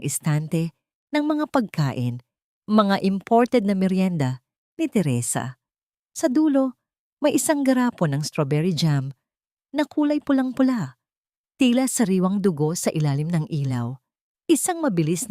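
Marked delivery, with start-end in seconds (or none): none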